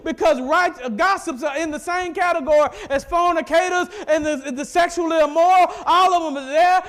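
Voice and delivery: loud, rising sermon voice; preaching at full force. Acted. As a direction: preach it hard to the congregation.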